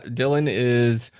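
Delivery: ordinary talk, nothing marked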